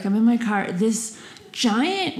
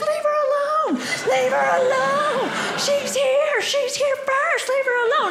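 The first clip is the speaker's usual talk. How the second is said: crowd shouting back; 14 semitones higher than usual. Retold or imitated. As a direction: imitate an indignant crowd defending someone. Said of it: "they start shouting back".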